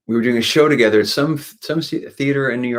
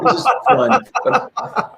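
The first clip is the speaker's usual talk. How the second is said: high, laughing through the words; real laughter breaking in; 3 dB louder, much slower than usual. Real, not acted.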